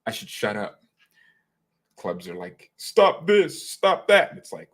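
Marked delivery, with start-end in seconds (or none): 2.8-4.3 loud, higher mock orders; mock scolding. Clubs telling off others, mock scolding. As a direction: call it out like a fussy club.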